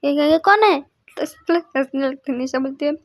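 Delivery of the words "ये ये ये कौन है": high, loud frightened cry; startled fright. A narrator's reading. Girl scared by her own painted face, shrieking. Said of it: "डर जाती है".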